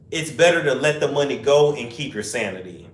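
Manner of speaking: normal talking voice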